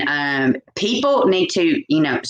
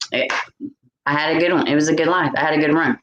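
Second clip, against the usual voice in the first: resigned shrug; 6 semitones lower, much faster than usual. Pretend. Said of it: "just say".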